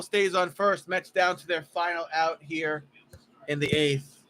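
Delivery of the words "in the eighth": normal talking voice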